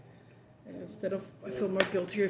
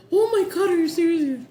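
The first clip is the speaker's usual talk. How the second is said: high, loud mock shriek of disbelief; shocked shriek; 10 semitones higher, 10 dB louder than usual. Retelling the van worker's reaction, a high, loud shocked shriek. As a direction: shriek it like she's just caught her mistake. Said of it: "And she's like".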